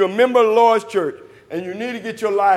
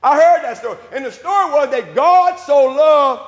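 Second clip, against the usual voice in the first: ringing sermon delivery, stretched key words; full-force preaching; 4 semitones higher, 4 dB louder than usual. Acted. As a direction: preach it out to the whole church.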